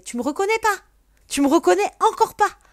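mock yelling woman. Stranger at the window shouting at her, angry yelling. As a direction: yell it like an offended acquaintance. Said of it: "elle me gueule dessus".

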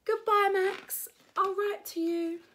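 high, loud goodbye shout; farewell call. Acted. Preston leaving Max at the seaside, loud farewell call. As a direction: call it back to a friend left behind.

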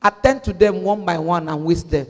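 preacher leading a prayer, drawn-out words, punched phrases; forceful prayer. Shown as a demonstration. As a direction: pray it out slowly and forcefully for them to repeat. Leads congregation in a prayer.

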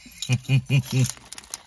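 loud burst of laughter. Real, not acted. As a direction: let out a big laugh. Laughter after joke.

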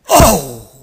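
very loud "oh" of being struck; sudden acted yell; loud cry on impact. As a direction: cry out as if hit in the chest. Acting out his reaction to the hit.

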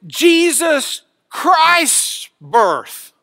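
"Jesus Christ's birth" loud, high, very slow; emphatic red-ink words. Shown as a demonstration. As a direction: hammer out each word of "Jesus Christ's birth". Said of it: "emphasize my voice for red ink".

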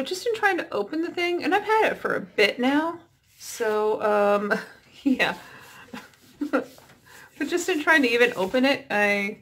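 mask on face while talking; speaking under face mask; through a sheet mask. Real, not acted.

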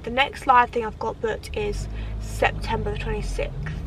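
ordinary talk, nothing marked

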